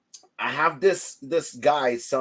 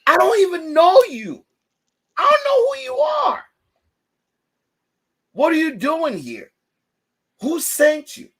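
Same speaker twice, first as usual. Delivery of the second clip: high, loud mock panic at a stranger; shrieking in fake alarm at the surprise guest; mock shouting; 7 dB louder than usual. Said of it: "I'm like".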